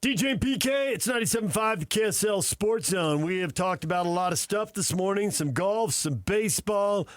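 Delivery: bright on-air promo voice; radio announcer. Acted. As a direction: sell the station call like a DJ. Radio host segment intro.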